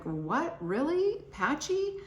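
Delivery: mock shock at the news; surprised disbelief. Retelling her reaction to patchy brows, high surprised rise. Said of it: "I'm like".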